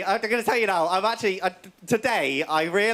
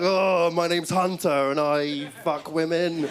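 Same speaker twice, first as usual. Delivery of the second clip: lower, slower macho brute voice; macho big guy; 5 semitones lower, slower than usual. Retold or imitated. Big Gladiators contestant boasting, low and slow. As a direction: drop it low and slow like a meathead. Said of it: "the big guy would be like".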